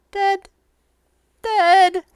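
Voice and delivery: very high, mournful little creature; sad flying monkey. Acted. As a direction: answer sadly as a little monkey.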